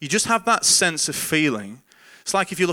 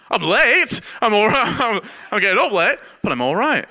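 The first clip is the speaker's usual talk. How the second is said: startled waking; 9 semitones higher, 6 dB louder than usual. Retold or imitated. Startled awake, answering the voice.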